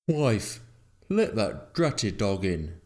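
slow, emphatic order to the wife; lightly voiced grumpy farmer; irritated farmer. A narrator's reading.

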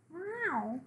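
very high meow; cat's meow. Acted. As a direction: mew like a cat in a high little voice. Cat replies to narrator.

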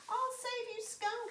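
high, loud heroic call. Acted. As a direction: call up to a friend in trouble. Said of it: "she called".

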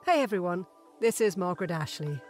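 usual speaking voice

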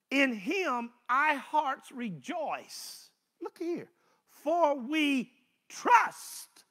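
slow, solemn psalm reading; scripture reading. Acted. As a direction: stretch each word of the verse like a prayer.

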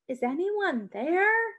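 wary man asking. A narrator's reading. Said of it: "the man asked".

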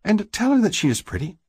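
high-pitched sister voice; high sister nudging her brother; sister's quick advice. A narrator's reading. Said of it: "and quickly adds".

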